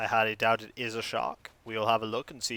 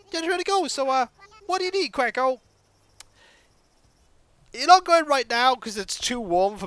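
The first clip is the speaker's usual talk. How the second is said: villager voice; 11 semitones higher, 4 dB louder than usual. Acted. Voices game villager lines.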